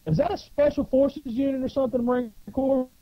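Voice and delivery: high, worked-up questioning; retold indignant challenge. Marine grills a poser about his unit, indignant. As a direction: act out an agitated interrogation.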